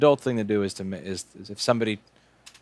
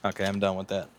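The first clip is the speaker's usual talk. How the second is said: normal talking voice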